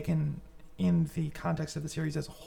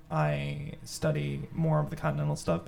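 ordinary talk

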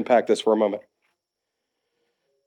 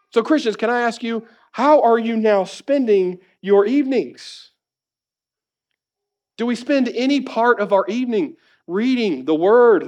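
raised, emphatic sermon delivery; impassioned preaching; 10 semitones higher than usual. Real, not acted. Preacher urging Christians to spend evenings in the word, impassioned. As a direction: press it home to the congregation, pitched high.

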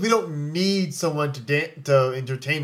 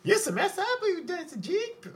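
mock servant voice; 5 dB quieter than usual. Mock servile voice opening.

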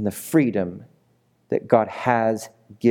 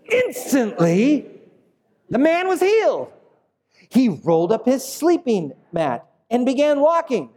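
reading the verse high and full of energy, pitch leaping up; energetic reading; 13 semitones higher than usual. Shown as a demonstration. Pastor reading the healing verse to the congregation, with high, bursting energy. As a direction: launch the verse like big news. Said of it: "You gotta say it with some energy".

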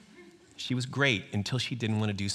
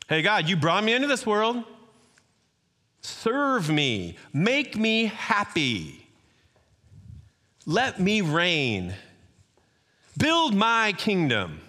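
higher, louder and very slow, each demand drawn out; entitled demand; 8 semitones higher, 4 dB louder, slower than usual. Acted. Preacher voicing a selfish heart ordering God around, slow and loud. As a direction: lord it over God like a spoiled king.

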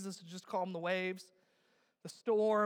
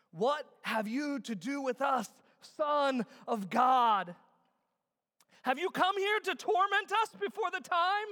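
acted demons' raised cry; slow cry that climbs higher at the end; 6 semitones higher, 6 dB louder, slower than usual. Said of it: "they cried out".

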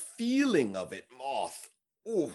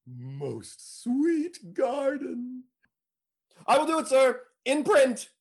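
hammy stage actor; 10 semitones higher, slower than usual. Acted. Costard gloats over his tip.

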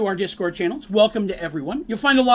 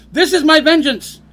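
a cackling little revenge, louder and higher; laughing gloat; 7 semitones higher, 8 dB louder than usual. Real, not acted. Streamer teasing a frustrated viewer, laughing gloat. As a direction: laugh it out, loud, gleefully triumphant.

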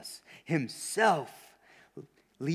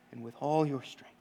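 quiet close; 2 semitones lower, 5 dB quieter than usual. Acted. Preacher ending the recitation, suddenly soft. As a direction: let the last line fall softly. Same words dropped to low voice.

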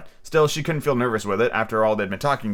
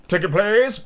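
guard; 9 semitones higher, 3 dB louder, slower than usual. A narrator's reading. Guard voiced in read story.

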